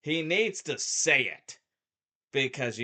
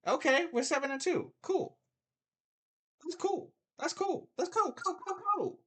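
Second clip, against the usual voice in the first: much higher, dismissive "cool" on repeat; eye-rolling fake approval; sarcastic cool. Real, not acted. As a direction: brush it off mockingly.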